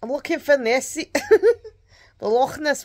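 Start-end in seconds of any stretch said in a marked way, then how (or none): 0.0-1.1 a weak, higher-pitched attempt at a Scottish accent; half-hearted Scottish accent; bad Scottish accent. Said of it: "I can't do my Scottish accent very well tonight". 1.2-1.7 burst of laughter. Real, not acted. Laughing at own failed accent.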